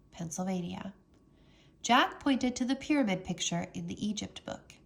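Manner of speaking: ordinary delivery, nothing marked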